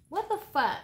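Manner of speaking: high, loud what the fuck; exasperated outburst. Real, not acted. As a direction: shout it in disbelief. Sudden irritated outburst.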